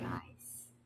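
whispered aside to the viewers. Real, not acted. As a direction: breathe the word out unvoiced.

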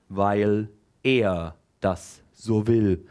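low, very slow portentous voice; solemn prophet. Acted. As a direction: announce it like divine law. Teacher playing prophet.

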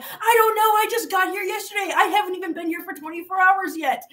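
high-pitched, hammed-up wail; mock bawling. New resident answering firemen about lost cat, mock bawling. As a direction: imitate yourself wailing helplessly, pitched right up. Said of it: "I'm bawling my head out".

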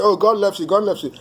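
high, lilting imitation of easy comfort; glib reassurance. Preacher mocking what people casually say, glib reassurance. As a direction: mimic people repeating a pat phrase. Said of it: "because people will say".

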